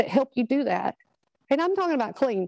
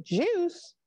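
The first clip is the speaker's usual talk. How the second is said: high, softer questioning echo of one word; imitating a baffled policeman, pitched up; puzzled query; 6 semitones higher, 3 dB quieter than usual. Policeman questions son about the box, puzzled. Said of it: "And he went".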